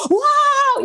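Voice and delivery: retold high squeal of delight; excited screaming. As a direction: imitate shrieking for joy on the phone. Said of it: "saya teriak-teriak di telepon loh".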